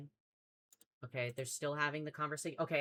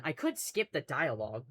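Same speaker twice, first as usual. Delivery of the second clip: ordinary talk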